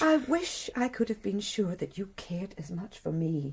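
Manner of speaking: soft unvoiced whisper; wife whispering her doubt to her husband; whispered confession. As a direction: breathe it to him, barely heard.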